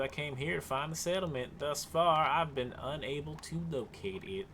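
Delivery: put-on Southern accent. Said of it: "solidified ourselves into Southern".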